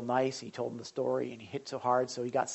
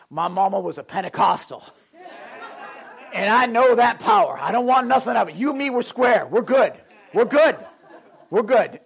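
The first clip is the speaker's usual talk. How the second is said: higher and louder, an anxious con backing off; nervous tough guy; 9 semitones higher, 9 dB louder than usual. Retold or imitated. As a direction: hurry to make peace with the preacher. Gang leader voiced backing down.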